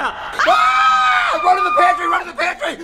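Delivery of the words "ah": panicked high scream. Acted. Fleeing from knife-wielding frog.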